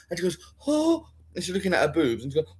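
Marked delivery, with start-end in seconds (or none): 0.7-1.1 high mock gasp of "oh"; short, high-pitched acted gasp; shocked gasp. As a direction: imitate a startled discovery. Acting her shocked discovery.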